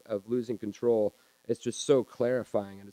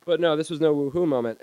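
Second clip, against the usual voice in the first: usual speaking voice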